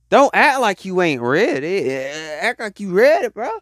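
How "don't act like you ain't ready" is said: ordinary delivery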